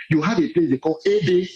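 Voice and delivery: usual speaking voice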